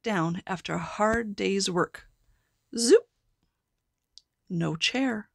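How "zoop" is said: a sudden loud zoop, rising. A narrator's reading. Storyteller making the wand go off with a loud zoop. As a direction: snap it out like a magic zap.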